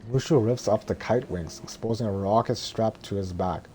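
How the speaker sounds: ordinary delivery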